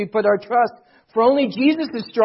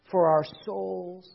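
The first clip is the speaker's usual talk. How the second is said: hushed solemn preaching; 7 semitones lower, 14 dB quieter than usual. Real, not acted. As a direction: close reverently with long pauses.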